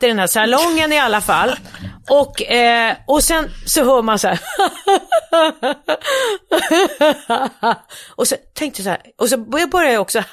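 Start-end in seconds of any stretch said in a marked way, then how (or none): 4.5-7.7 high, forced fake laugh mimicking the cinema crowd; fake audience laugh. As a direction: imitate a hollow theatre laugh. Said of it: "skrattar på så här otroligt oäkta sätt". (fejkat, högt publikskratt)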